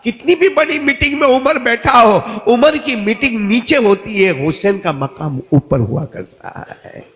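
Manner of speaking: loud, high sermon delivery easing down at the end; full-force preaching. Retold or imitated. Preacher quoting Umar's tribute to Hussain, full-force preaching.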